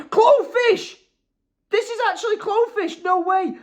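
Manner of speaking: high excited squeal of recognition; can't believe who it's from; thrilled squeal. Real, not acted. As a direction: fan squealing over a gift from a favourite mod. Realises it's a favourite mod.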